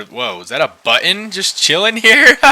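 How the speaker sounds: loud delighted whoa; surprised laugh. Real, not acted. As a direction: burst out in surprise.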